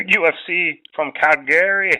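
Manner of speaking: a breezy Irish lilt on the card's name. Pretend. Host opens on the Calgary card in a light Irish accent. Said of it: "went with the Irish voice".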